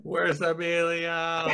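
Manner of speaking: high, drawn-out playful echo; stretched high mock wail; mock calling out. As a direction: call it out high and slow, joking. Echoes the co-host's mock fan cry.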